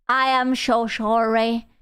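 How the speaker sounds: usual speaking voice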